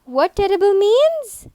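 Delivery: teacher modelling the question loud; model question for repeating. Shown as a demonstration. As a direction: call it out so they repeat it. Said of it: "please repeat after the teacher".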